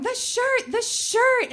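high, frantic retold yell. Wife frantic about her ruined shirt, yelling at husband. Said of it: "I start yelling".

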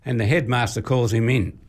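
usual speaking voice

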